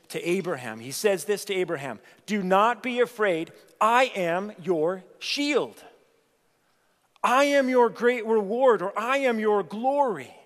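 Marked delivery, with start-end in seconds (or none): 2.3-10.3 loud, proclaiming God's promises; ringing divine assurance; booming voice of God. Acted. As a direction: declare it for God.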